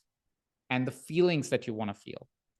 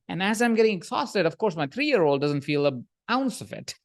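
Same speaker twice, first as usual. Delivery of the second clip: ordinary delivery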